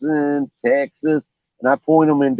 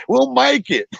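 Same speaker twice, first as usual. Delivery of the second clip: much higher carefree old-man imitation; buddy's voice; slower than usual. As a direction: say it high and unbothered as the old buddy. Buddy voiced in boat story.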